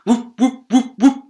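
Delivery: high, loud whooping propeller noise; spinning propeller sound effect; whooshing stick. Acted. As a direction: make the stick whoop through the air.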